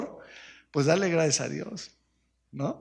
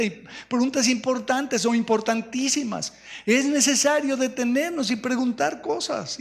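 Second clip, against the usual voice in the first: high, raised preaching; 7 semitones higher than usual. Acted. Pastor drives the point home to the church, preaching. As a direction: build it like a preacher.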